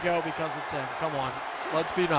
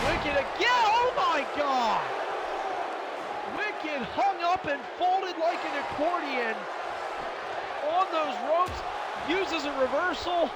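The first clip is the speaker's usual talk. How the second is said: excited shout; 6 semitones higher than usual. Real, not acted. Commentator shouting at big spot.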